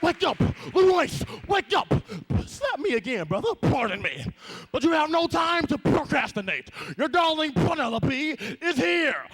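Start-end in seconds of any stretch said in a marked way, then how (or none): 0.0-1.9 wake-up call. Acted. Waking the dreamer. 4.7-9.2 urgent shout. Acted. Urgent announcement.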